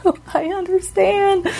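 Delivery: high, mock sympathetic reply; imitating herself politely sympathizing on the phone. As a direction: play along sweetly. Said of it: "Just like".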